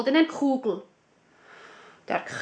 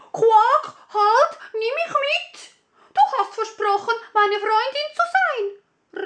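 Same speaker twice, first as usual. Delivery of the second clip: much higher, loud frog voice calling after her; the frog crying out to the fleeing princess; 11 semitones higher, 4 dB louder than usual. Acted. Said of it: "rief der Frosch". (hohe, laut rufende Froschstimme)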